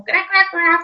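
high silly cartoon croaking; funny voice. Shown as a demonstration. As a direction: croak it like a goofy toy bird. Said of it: "говорить смешным таким голосом".

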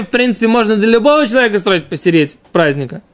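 normal talking voice

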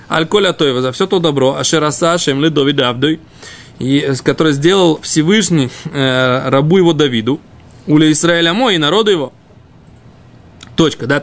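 ordinary talk, nothing marked

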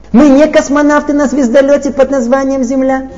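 ordinary talk, nothing marked

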